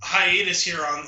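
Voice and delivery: ordinary delivery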